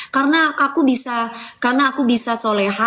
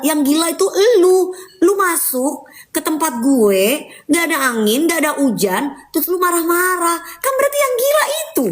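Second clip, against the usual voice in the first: indignant retort; 7 semitones higher than usual. Real, not acted. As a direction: throw the insult back at the hater. Retorting angrily at a hater. (membalas haters dengan kesal)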